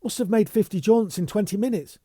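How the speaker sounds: breathless urgency. Acted. Bunny breathless, urgent report.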